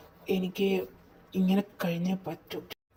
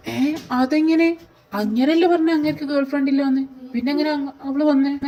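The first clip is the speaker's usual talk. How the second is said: loud, raised-pitch questioning; shocked disbelief; 7 semitones higher, 8 dB louder than usual. Acted. Friend hearing the supposed ex turned up, shocked.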